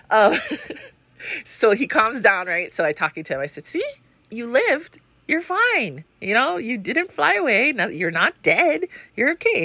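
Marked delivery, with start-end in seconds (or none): 3.6-9.6 put-on higher, encouraging pep talk to a child; a lightly voiced cheerful mom; reassuring mom. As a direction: praise the kid for surviving. Mom reassuring son after drive.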